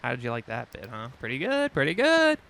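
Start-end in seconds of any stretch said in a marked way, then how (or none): 1.2-1.7 pleased. Pretend. Self-praise repeated. 1.7-2.4 high, loud pretty good; gleeful squeal. Pretend. Self-praise, second louder take.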